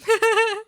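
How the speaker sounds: sudden loud high laugh; high burst of laughter. Real, not acted. To chat after a failed name, loud high laugh. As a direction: cackle at the miss.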